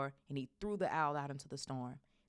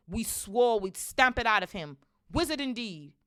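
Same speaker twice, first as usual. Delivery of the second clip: loud, raised indignant voice; indignant uncle; 7 semitones higher, 10 dB louder than usual. A narrator's reading. Uncle swearing to stamp out wizardry, indignant. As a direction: put your foot down, loudly.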